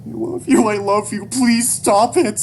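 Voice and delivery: very high, loud plea; desperate begging. Acted. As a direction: cry it out, dragging the final plea. Child begs father not to shoot.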